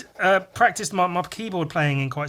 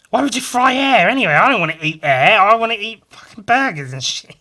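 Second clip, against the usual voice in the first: loud, fast mock outrage; mock rant; 2 semitones higher, 10 dB louder, faster than usual. Streamer ranting at nobody about frying air, mock outraged. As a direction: rant indignantly, at full volume.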